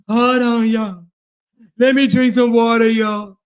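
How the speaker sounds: hammed-up out-of-breath pleading; mock winded. Streamer imitating rivals quitting the workout, mock winded. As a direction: imitate someone gasping between words. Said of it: "They be like".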